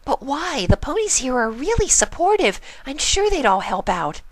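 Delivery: high, quick, serious pony voice; earnest Pinkie. Acted. Pinkie confiding in Spike, high and earnest. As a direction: confide a big secret, high and fast.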